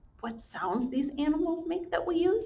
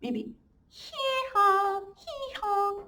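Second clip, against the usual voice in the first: donkey hee-haw; 10 semitones higher, much slower than usual. Shown as a demonstration. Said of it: "can you guys make a donkey noise?".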